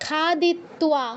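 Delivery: slow খাদিত্বা, ending in twa; sounded w. Shown as a demonstration. Teacher gives students an example, খাদিত্বা with twa. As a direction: draw out খাদিত্বা and voice its w. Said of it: "এরকম".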